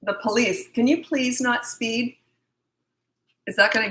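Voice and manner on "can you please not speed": slow, higher, mock-meek asking; timid polite request. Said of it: "It'd be like the, the police".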